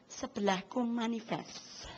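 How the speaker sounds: slow, soft hushed aside; hushed remark. Retold or imitated. Churchgoer murmuring about the preacher's strange voice. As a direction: murmur it to the neighbour, slowly. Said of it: "sebelah saya itu langsung bilang gini".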